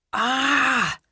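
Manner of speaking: sharp high cry of shock; sudden loud cry of alarm; startled cry. A narrator's reading. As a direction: cry out as if struck by sound. Roar in her head startles her.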